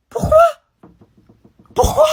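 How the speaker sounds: very high, loud squeal; high-pitched protest. Acted. Pupil protesting the chewing-gum ban, high indignant squeal.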